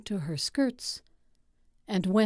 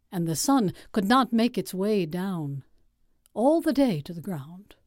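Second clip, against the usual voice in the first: usual speaking voice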